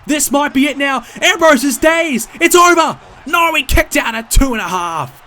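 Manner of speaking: loud, high near-fall call; wrestling commentator. Real, not acted. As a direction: scream the near-fall, peak on it's over. Near-fall during live match call.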